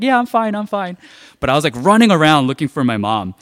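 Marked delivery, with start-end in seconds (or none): 0.0-1.0 feigned nonchalance. Acted. Lost kid brushing off onlookers.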